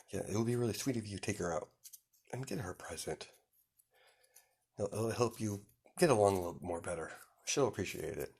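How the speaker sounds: soft, quick confidential aside; hushed coaxing. Acted. Mother privately urges son to take her out, hushed.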